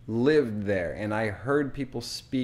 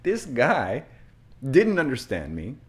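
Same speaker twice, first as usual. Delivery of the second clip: ordinary talk, nothing marked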